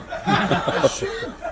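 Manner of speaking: a short unvoiced laugh; breathy laugh. Real, not acted. Comedian laughing mid-joke, a short breathy laugh. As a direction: chuckle silently at the setup.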